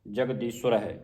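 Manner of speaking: ਜਗਦੀਸ਼ਵਰ said as jagdisura; tight pronunciation. Shown as a demonstration. Teacher repeats ਜਗਦੀਸ਼ਵਰ tightly for students. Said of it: "ਅਧਿਕ ਲਾ ਕੇ ਪੜ੍ਹਨਾ ਹੈ".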